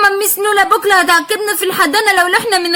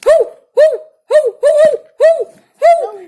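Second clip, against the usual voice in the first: high yapping barks; dog barks; 8 semitones higher, 3 dB louder than usual. Acted. Dogs barking at the vendor in the dark, high yapping barks. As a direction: bark like a street dog.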